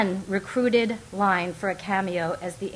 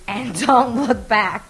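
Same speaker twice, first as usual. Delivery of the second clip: panting; 3 semitones higher, 7 dB louder, slower than usual. A narrator's reading. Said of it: "she'd pant".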